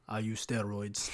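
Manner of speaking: put-on slow, low, soft Russian-sounding accent; German slipping into Russian. Big gym guy admitting steroids, low Russian-style accent. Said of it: "That was, like, more Russian".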